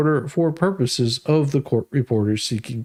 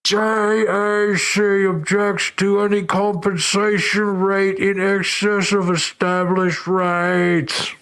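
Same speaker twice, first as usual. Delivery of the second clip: slow, higher, pompous mock-legal recital; mocking official reading; 5 semitones higher, slower than usual. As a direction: read it like a smug bureaucrat, slowly. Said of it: "I like how J A C says this".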